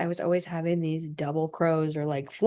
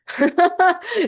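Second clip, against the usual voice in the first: sudden high burst of laughter; a quick wry laugh at herself; short laugh; 12 semitones higher, 6 dB louder than usual. Real, not acted. Bitter laugh at abrasive.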